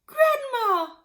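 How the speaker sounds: high, loud shriek. Acted. Missus Cranky shrieking at her shrinking mother. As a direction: shriek her name in alarm. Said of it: "shrieked Missus Cranky".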